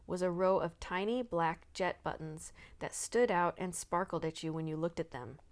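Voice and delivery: usual speaking voice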